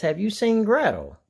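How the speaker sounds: Hansel voice. A narrator's reading. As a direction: ask it as young Hansel. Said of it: "Hansel asks".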